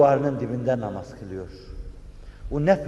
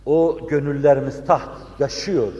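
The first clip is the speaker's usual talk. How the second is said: ordinary delivery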